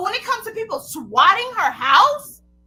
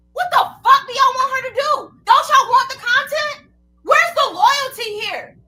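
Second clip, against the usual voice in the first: angry shout; 4 semitones higher, 5 dB louder than usual. Real, not acted. Angry rant at accusers.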